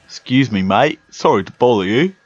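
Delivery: lively northern English trainer voice; northern English accent. Acted. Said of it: "talk in their accent".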